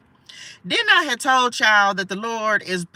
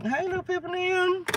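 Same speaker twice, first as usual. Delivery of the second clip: high, soft little sing-song hello; high greeting; 10 semitones higher, 6 dB quieter than usual. Acted. Reader greets spirits in a high soft coo.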